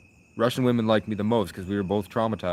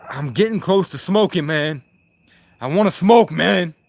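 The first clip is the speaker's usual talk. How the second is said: loud, higher complaint that he wants to smoke; loud whining; 8 semitones higher, 8 dB louder than usual. Real, not acted. Streamer begging chat to let him smoke, loud whine.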